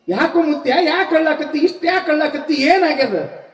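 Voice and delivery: high, urgent, repeated asking; insistent concerned questioning. Acted. Boy asking a weeping old man why he cries, insistent.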